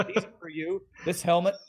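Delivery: gushing over the helmet; excited Rev. Acted. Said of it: "He's like".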